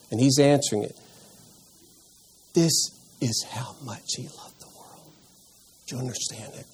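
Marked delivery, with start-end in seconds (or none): 2.5-6.7 hushed whisper, full of wonder; awed whisper. Shown as a demonstration. Teacher voicing wide-eyed John to the group, awed whisper. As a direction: breathe it out in a whisper, amazed. Voicing the gospel writer's amazement.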